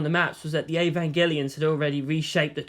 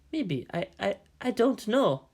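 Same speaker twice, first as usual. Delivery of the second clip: nervous stammer; 4 semitones higher, 3 dB quieter than usual. A narrator's reading. Ambassador unsure under questioning.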